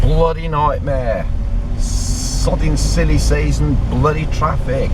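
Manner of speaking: slow, drawn-out exasperation; real grumbling. Real, not acted. Driver stuck in silly season traffic, grumbling.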